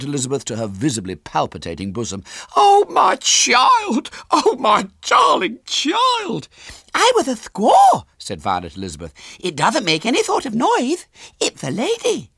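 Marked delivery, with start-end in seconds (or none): 2.6-6.5 very high, loud, drawn-out wail; gushing mother. A narrator's reading. Mother clutching her found child, melodramatic wail. 6.9-8.1 put-on very high, loud child voice; little girl. A narrator's reading. Girl telling her mother about the game, piping loudly. 9.4-12.3 put-on high, louder child voice; little girl explaining. A narrator's reading. Girl explaining the game to mother, high and childish.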